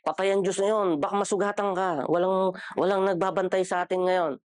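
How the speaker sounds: a hint of a stern old elder; old folks' warning. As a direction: imitate a provincial elder scolding children. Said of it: "Sinasabi nila".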